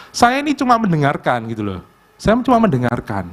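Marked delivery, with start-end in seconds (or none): none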